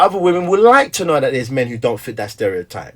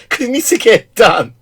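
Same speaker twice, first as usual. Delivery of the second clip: matter-of-fact reply; 9 semitones higher, 5 dB louder than usual. Retold or imitated. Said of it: "I'm like".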